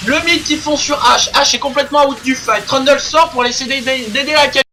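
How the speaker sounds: lively play-by-play call; esports caster. Real, not acted. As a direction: call the fight live, rising energy. Live play-by-play commentary.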